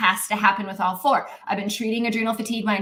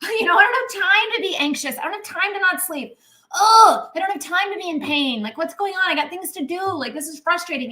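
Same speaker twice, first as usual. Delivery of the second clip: higher mock exasperation, a quoted complaint; exasperated, a touch of whining, pitched up; frustrated rant; 7 semitones higher than usual. Stressed-out client venting about having no time, mock frustrated. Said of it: "We're like".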